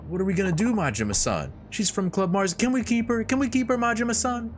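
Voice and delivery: excited Yuki begging. Acted. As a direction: beg like a kid for a puppy. Yuki's excited pleading.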